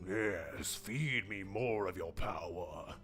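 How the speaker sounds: slow, breathy monster whisper; gloating troll whisper. Acted. The troll relishing the players' fire, in a slow whisper. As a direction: savour the fire like a hungry monster.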